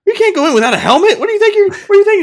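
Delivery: high, loud, fast "what do you think"; squeaky coach yelling in disbelief, laughing; high, loud incredulous coach. Retold or imitated. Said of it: "He's like".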